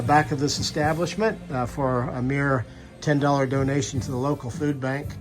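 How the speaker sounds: usual speaking voice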